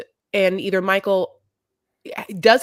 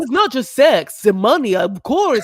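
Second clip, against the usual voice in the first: mock Andre voice; 5 semitones higher, 6 dB louder than usual. Mocking Andre's defense.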